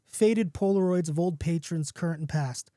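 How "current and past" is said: ordinary delivery, nothing marked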